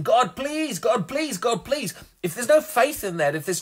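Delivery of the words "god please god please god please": high-pitched imitation of pleading; acted-out desperate plea; mock begging. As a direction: beg over and over in a high voice. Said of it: "just coming and begging".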